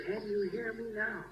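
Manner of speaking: soft whispered question. Retold or imitated. Father testing acoustics, whispering to distant son. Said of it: "I finally got down to a whisper".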